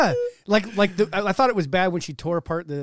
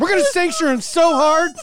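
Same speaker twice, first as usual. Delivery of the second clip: higher and louder, laughing hard as he says it; mock threat through laughter; 11 semitones higher, 9 dB louder than usual. Mocking politicians' empty threats to listeners, laughing loud. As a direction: crack up while vowing to sanction him so hard.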